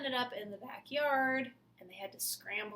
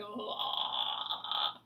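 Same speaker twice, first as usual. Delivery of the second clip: zombie groan; 4 dB louder than usual. Acted. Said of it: "the zombies were going".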